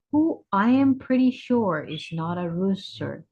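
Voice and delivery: plain reading voice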